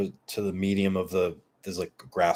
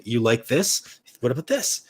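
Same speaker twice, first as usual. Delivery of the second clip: raised, eager questions to a dog; playful coaxing; 5 semitones higher, 6 dB louder than usual. Retold or imitated. Owner offering dog toys in store, playful coaxing. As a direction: tempt a pet, rising at each ending.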